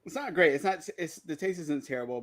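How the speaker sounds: tight, quiet voice through the heat; struggling to speak past the burn; burning mouth. Real, not acted. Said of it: "wipe the tears here".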